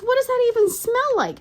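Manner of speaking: very high, loud mock teen disbelief; outraged teenager. Mother mimicking her teenager questioning the insult, outraged. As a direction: squeal it like a scandalized teen. Voices baffled, offended teenager.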